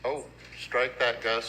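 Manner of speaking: usual speaking voice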